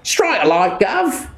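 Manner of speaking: put-on high Cockney accent, slow and wheedling; hammed-up Cockney accent, high and pleading; Cockney geezer. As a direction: play the Cockney conman protesting his honesty. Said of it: "this Cockney geezer in his flat cap says".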